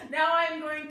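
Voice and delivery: loud high laugh spilling into the words; real giggle fit while talking; burst of laughter. Real, not acted. Bursts out laughing mid-sentence.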